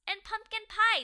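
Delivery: high, loud indignant cry; loud complaint. Acted. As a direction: demand the pie loudly. Patty complains about the menu.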